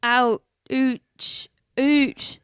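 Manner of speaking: Scottish "ouch". Retold or imitated. Host imitating a Scotsman saying ouch, mock Scottish yelp. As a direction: yelp "Ooch" like a Scotsman hurt. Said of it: "Sounds like a Scottish man saying".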